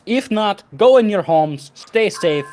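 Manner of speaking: shouting over crowd. Acted. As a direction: project a firm appeal across the crowd. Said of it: "Boris is gonna shout over the crowd".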